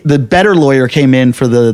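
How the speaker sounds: usual speaking voice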